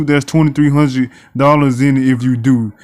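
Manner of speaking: ordinary talk, nothing marked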